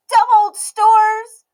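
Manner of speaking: put-on slow, loud, high grumbling child; a sulking girl stretching each word; sulky Emmy. A narrator's reading.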